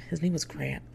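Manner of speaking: ordinary delivery, nothing marked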